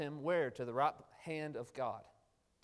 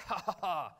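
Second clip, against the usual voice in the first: mock gloating laugh; 3 semitones higher, 4 dB louder than usual. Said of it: "it wasn't like".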